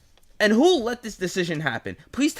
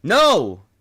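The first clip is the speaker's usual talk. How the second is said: emphatic no; 8 dB louder than usual. Real, not acted. Answering own mock question.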